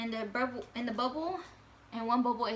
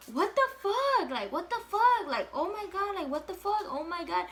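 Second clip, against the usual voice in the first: much higher, louder, panicked disbelief that settles toward the end; panicked freak-out; 9 semitones higher, 4 dB louder than usual. Retold or imitated. Girl seeing her positive pregnancy test, high panicked freak-out. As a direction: spiral into a high-pitched panic.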